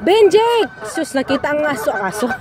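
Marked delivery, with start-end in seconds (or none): 0.0-0.7 calling out loudly. Real, not acted. Calling child sharply.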